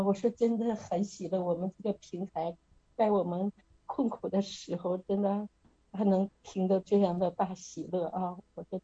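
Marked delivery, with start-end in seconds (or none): none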